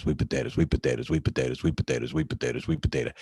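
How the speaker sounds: low rumbling chant of sweet potatoes, like a V-twin idling; Harley engine sound. Retold or imitated. Rider imitating his Harley's exhaust, low rumbling chant.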